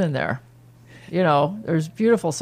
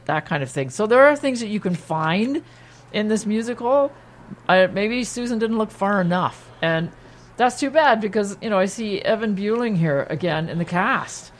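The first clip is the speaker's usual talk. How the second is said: usual speaking voice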